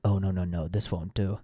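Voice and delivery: put-on low fussy old priest; lightly voiced old priest. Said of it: "and he said".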